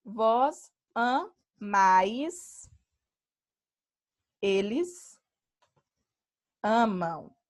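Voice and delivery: each form said very slowly and clearly; slow conjugation demo. Shown as a demonstration. Teacher to students, showing the conjugation, very slow and deliberate. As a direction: spell out each verb form slowly for the class. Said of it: "o radical não muda".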